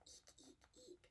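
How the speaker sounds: tiny whispered eek; monkey eek. Pretend. Teacher whispering a monkey call. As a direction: eek under the breath.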